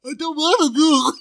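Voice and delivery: high loud mocking garbled voice, laughing; mocking impression. Friend retelling an impression of a girl's speech, laughing mockery. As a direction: mimic a slurred voice cruelly while laughing. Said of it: "all you hear is".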